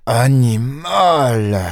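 slow insult squeezed through the teeth; ground-out insult. A narrator's reading. Ganimard cursing Lupin through gritted teeth, slow and grinding. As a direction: spit it out slowly through clenched teeth. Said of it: "grinça Ganimard entre ses dents".

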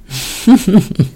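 short nervous giggle; nervous laugh. Real, not acted. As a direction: laugh off the nerves. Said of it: "mais c'est un rire nerveux".

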